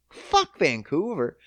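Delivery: ordinary delivery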